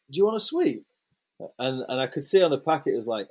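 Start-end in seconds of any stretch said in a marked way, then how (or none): 0.1-0.8 put-on higher, childlike mischievous offer; little girl's voice. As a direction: play the cheeky little girl teasing him. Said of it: "And she, she was like".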